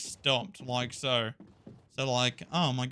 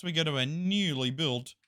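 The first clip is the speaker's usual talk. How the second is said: normal talking voice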